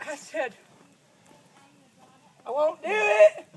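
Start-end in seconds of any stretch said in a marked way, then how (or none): 2.5-3.5 louder, a flat stubborn no; defiant loud refusal. Retold or imitated. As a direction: slam the refusal down like a pouting kid. Child defiantly refuses father again.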